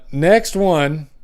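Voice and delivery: next one, stretched long and slow; slow announcement. Shown as a demonstration. Host reading funnies announces the next one, drawn out slowly. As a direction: drag out the announcement like a game show host. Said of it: "drawn-out announcement of next joke".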